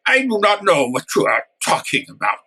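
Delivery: fake Russian accent. Guest fending off the fun guy joke in fake Russian accent. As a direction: put on a stilted Russian accent. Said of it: "maybe a Russian accent".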